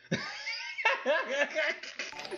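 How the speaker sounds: high-pitched laughing fit; long, helpless high laugh; burst of laughter. Real, not acted. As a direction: crack up laughing at your own joke. Bursts out laughing at own answer.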